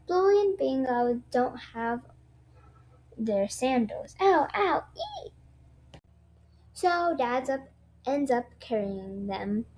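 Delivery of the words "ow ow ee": high pained yelps; yelping in pain. Acted. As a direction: hop and yelp at the hot ground. Hot footpath, bare feet.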